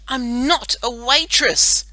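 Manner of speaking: slow, loud, high and annoyed; snapped. A narrator's reading. Girl corrects the boy superhero, snapping. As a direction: correct him crossly, word by word. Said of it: "Melanie snapped".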